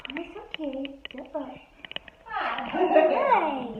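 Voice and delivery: ordinary delivery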